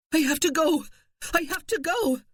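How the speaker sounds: high frantic pleading; desperate, rising panic; panicked. Acted. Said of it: "Panic was upon her".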